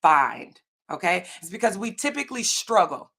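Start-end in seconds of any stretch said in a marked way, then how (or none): 0.0-0.6 "find" set apart after a pause, falling; stress on find. Real, not acted. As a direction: land firmly on "find" as one isolated word. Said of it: "why am I emphasizing the word".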